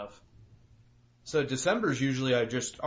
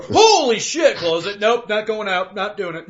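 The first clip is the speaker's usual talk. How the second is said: mock shocked yell; 7 semitones higher, 10 dB louder than usual. Opening door into freezing cold.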